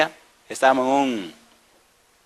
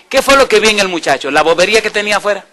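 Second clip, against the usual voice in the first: loud question; 7 semitones higher, 8 dB louder than usual. Acted. Preacher's contrasting rhetorical questions.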